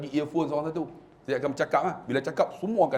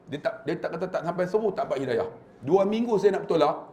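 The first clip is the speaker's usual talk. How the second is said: usual speaking voice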